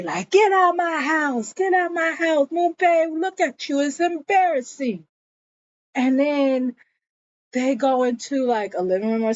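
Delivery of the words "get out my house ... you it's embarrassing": high, raised-voice scolding; mock angry yell; retold yelling. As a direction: imitate someone yelling everybody out. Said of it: "Jonas yells at everybody".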